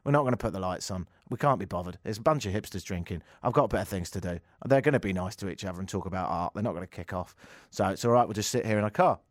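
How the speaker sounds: can't be bothered, mumble it; voicing the police who won't leave their car; bored police. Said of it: "They thought".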